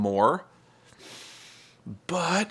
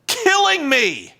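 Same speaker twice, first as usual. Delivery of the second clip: much higher, louder, stretched out slowly; anguished yell; 13 semitones higher, 4 dB louder, slower than usual. Real, not acted. Ranting to viewers about TNA, anguished drawn-out yell. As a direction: wail it like it's actually killing you.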